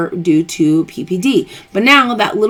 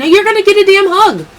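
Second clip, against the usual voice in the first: playful demand; 12 semitones higher, 6 dB louder than usual. Retold or imitated. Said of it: "I'm like".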